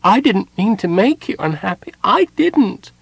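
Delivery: high, pleading kender voice; upset. Acted. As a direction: insist tearfully, voice rising.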